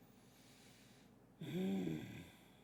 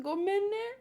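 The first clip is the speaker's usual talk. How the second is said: cute Iron Giant voice; 8 semitones higher than usual. Acted. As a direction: say it like a sweet giant robot apologizing. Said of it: "Panya said he sounds cute".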